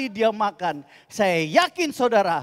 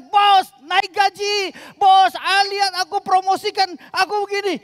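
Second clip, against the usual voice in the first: pushy employee; 14 semitones higher, 9 dB louder than usual. Acted.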